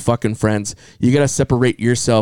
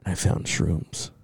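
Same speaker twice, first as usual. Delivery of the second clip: much softer, whispered mom imitation; quoting mom; 4 semitones lower, 9 dB quieter, faster than usual. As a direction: hush it like a secret. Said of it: "she said".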